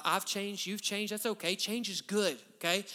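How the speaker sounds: usual speaking voice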